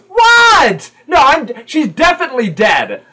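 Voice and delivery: put-on very high, loud child voice; blunt kid objecting. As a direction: squawk in disbelief, then insist loudly. Kid contradicts Sprat.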